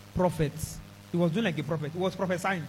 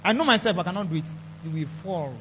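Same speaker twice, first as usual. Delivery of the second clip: ordinary delivery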